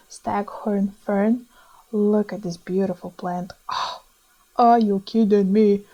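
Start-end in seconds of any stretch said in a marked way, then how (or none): none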